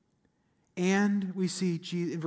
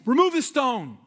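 commanding; 7 semitones higher, 6 dB louder than usual. Retold or imitated. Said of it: "He commands".

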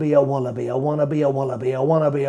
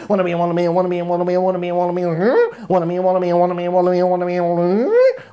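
louder and higher, building to a shrill peak; building frenzy; 6 semitones higher, 5 dB louder, much faster than usual. Acted. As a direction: whip it up to a frantic climax. Poem climaxes, louder higher.

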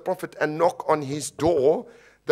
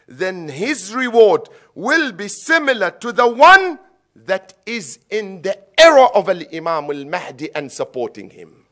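loud, raised-pitch sermon delivery; impassioned preaching; 4 semitones higher, 10 dB louder than usual. Real, not acted. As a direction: drive the teaching home to the congregation. Preaches at full force.

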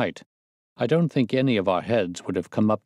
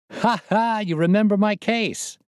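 much higher, proud mock laugh into the words; 12 semitones higher, 3 dB louder than usual. A narrator's reading. Prendergast gloats to the narrator about his case, proudly. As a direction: crow over his own fame. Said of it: "said he proudly".

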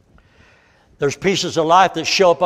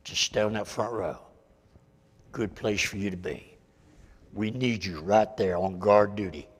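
much softer, lower, close and earnest; intimate sincere appeal; 5 semitones lower, 9 dB quieter than usual. Real, not acted. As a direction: lean in and speak straight to their hearts.

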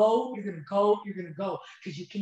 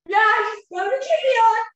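tearful loud reply; 11 semitones higher, 9 dB louder than usual. Retold or imitated. Tearful answer to the store lady.

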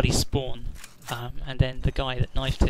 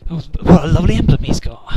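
loud, slightly raised exclamation; loud mock admiration; 4 semitones higher, 11 dB louder than usual. Real, not acted. Gamer spotting another player's rude emblem, loud mock admiration. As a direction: exclaim it loudly in mock delight.

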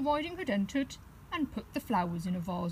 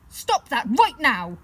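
loud, stern command; loud stern librarian; 4 semitones higher, 10 dB louder than usual. Acted. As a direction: order the wolf to stop, loud and stern. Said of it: "she sternly told the wolf".

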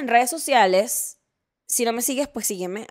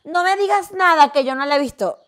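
mocking whiny fan; 5 semitones higher, 8 dB louder than usual. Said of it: "empieza alguien a hablar".

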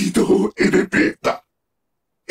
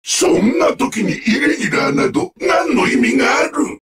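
normal talking voice

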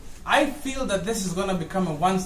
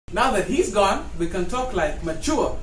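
ordinary talk

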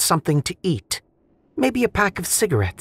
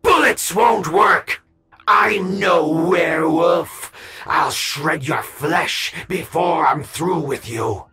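high, slow snarling threat; 9 semitones higher, 3 dB quieter, slower than usual. A narrator's reading. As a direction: snarl it like a monster. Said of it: "Mary glanced up at Wendy and snarled".

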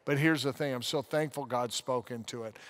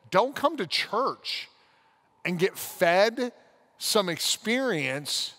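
slow, higher, weighted pulpit warning; earnest preacher challenge; preachy emphasis; 4 semitones higher than usual. Real, not acted. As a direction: drive each phrase home like a sermon.

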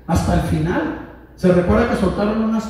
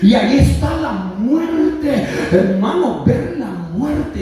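raised voice, key words drawn out; forceful preaching; 5 semitones higher, 4 dB louder, slower than usual. Acted. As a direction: drive it home from the pulpit. (predicación enérgica, con palabras alargadas)

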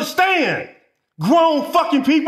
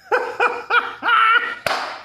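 mocking laugh; 10 semitones higher than usual. Real, not acted. Laughing at the clients.

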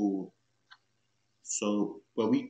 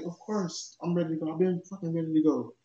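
exasperated; 5 semitones higher than usual. Retold or imitated. Impatient answer to friends.

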